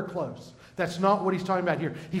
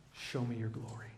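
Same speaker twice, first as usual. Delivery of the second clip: much softer, hushed "Show me your glory"; hushed plea; 6 semitones lower, 14 dB quieter than usual. Acted. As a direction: breathe the request in awe. Moses voiced making his request.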